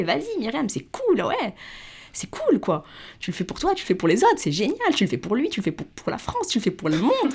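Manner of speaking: hyping yourself up, pitch leaping on key words; self pep talk. Retold or imitated. Young woman cheering herself on in her head, excited.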